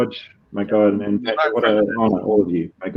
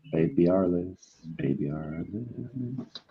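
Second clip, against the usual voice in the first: put-on slow, much softer "Baby Alice"; hushed title read; 4 semitones lower, 11 dB quieter, slower than usual. Shown as a demonstration. Closing the title reads, dropping to a hush. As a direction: let "Baby Alice" fade to a near hush. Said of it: "just say Baby Alice".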